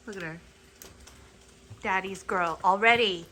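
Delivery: usual speaking voice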